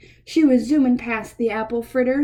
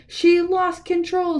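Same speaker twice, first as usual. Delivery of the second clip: screamed warning; 6 semitones higher than usual. Acted. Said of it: "screamed the Pagolian".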